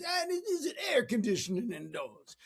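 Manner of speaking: high-pitched put-on accent; hammed-up folksy questioning; lousy Zig Ziglar. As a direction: do a bad impression of a motivational speaker. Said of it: "That was a lousy impersonation of Zig Ziglar".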